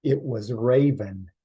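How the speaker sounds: ordinary delivery, nothing marked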